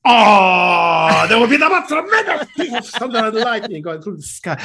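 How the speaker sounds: high, loud, theatrical weather forecast; Bruno impression. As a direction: gush over the storm like a flamboyant judge. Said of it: "You do Bruno doing the weather forecast".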